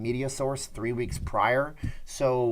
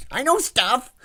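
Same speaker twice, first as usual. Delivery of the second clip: high, loud mock outburst; hammed-up high-pitched boast; Fredo impression; 12 semitones higher, 6 dB louder, slower than usual. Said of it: "Fredo said".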